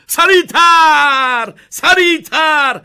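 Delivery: urgent high yelling, last syllables stretched; excited shouting. A narrator's reading. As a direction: urge them on at full volume. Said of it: "با هیجان عصاشو چرخوند و گفت".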